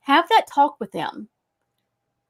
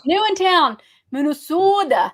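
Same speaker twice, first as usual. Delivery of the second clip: put-on higher, louder Minnesota accent; hammed-up Minnesotan accent; 5 semitones higher, 5 dB louder than usual. Teasing about Minnesota, put-on local accent. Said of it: "without saying Minnesota".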